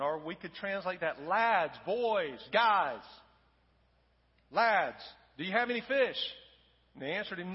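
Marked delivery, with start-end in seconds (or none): 1.2-6.2 loud hail to the boat, each word thrown far; Jesus hailing the disciples from the beach, loud calling; calling out across water. Acted. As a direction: shout from the shore to men out fishing. Jesus calls from the shore.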